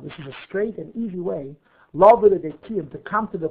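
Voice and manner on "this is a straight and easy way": ordinary talk, nothing marked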